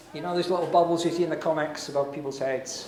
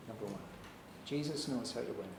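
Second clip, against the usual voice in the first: quiet repeat; 7 semitones lower, 15 dB quieter, faster than usual. Shown as a demonstration.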